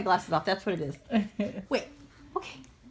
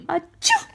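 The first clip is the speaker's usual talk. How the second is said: fake sneeze; 4 semitones higher, 3 dB louder than usual. Fake sneeze to make baby laugh.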